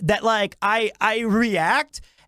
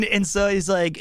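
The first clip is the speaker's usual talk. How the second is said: ordinary talk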